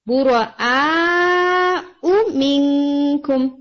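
بُرَآءُ with the آ stretched very long, slowly; long madd demo. Shown as a demonstration. Teacher demonstrating the long madd in بُرَآءُ, very slow. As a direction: hold the آ of بُرَآءُ for four or five counts. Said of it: "او کو آپ نے لمبا نہیں کرنا".

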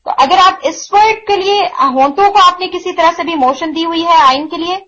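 ordinary talk, nothing marked

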